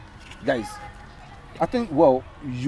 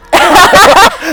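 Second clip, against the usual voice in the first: high, loud burst of laughter; 15 dB louder than usual. Real, not acted. Host and guest on having two girlfriends, loud laugh.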